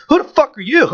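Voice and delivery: very high, affronted challenge; retold indignant retort. Driver retelling his retort to the security guard, indignant. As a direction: throw it back indignantly, pitch shooting up.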